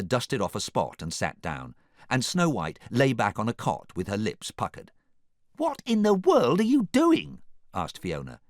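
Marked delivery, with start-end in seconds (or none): 5.6-7.4 high, loud, exasperated question; incredulous. A narrator's reading. Fiona questions the lounging princesses, loud and incredulous. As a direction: demand it in high disbelief.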